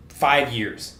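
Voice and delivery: normal talking voice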